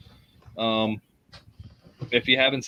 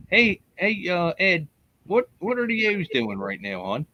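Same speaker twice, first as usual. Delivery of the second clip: put-on Baltimore accent; 6 semitones higher than usual. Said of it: "I can do my Baltimore voice right now".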